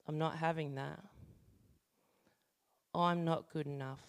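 soft pastoral prayer over the group; congregational prayer. Acted. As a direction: lead the room in prayer, quietly.

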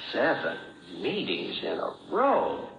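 "Seven meetings in a row" said slowly, each word weighed; slow, weighty repeat. Shown as a demonstration. Speaker repeating the old man's challenge, slow and weighty. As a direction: let "Seven" sink in, pause, then slowly finish the phrase. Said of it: "Would you go to seven meetings in a row?".

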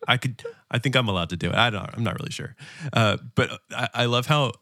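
ordinary delivery

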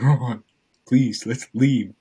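begging a dawdling friend to go, loud and slow; urgent pleading. Retold or imitated. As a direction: beg him to leave now, stretching please.

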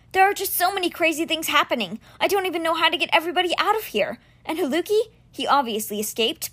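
put-on words flooding out of a frightened boy; anxious boy Alex. Boy telling his friend everything that's going wrong, anxious.

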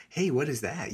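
usual speaking voice

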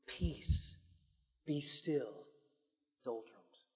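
soft, low calming command; quiet the sea with a hushed word. Acted. Jesus to the sea, soft calming command. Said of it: "All he had to do was use words".

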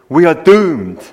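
mock gloomy Scottish accent, loud; doom-laden Scots wail of the catchphrase. Preacher quoting a gloomy Scottish soldier, Scottish accent. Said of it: "punctuate the dialogue with this catchphrase".

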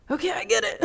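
giggling through a quoted admission; laughing self-quote. Retold or imitated. To the group, retelling her realization, laughing. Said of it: "I'm like".

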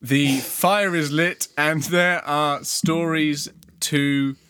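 slow, measured fireside storyteller delivery; storyteller's intro. Acted. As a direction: open the tale slowly, pausing before the last word. Scripted lead-in to the episode.

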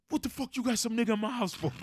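high, loud mock yell at his lady; retold furious yell. Boyfriend yelling at his girlfriend over the guest, high loud yell. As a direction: belt it out like he just found a stranger at his table. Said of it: "He goes".

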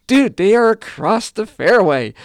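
loud mock plea across the fairway; exasperated call. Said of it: "I'm like".

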